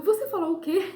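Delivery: high, loud startled "what did you say?"; shocked disbelief. Retold or imitated. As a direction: pitch it up sharply in total disbelief. Husband calls back in disbelief.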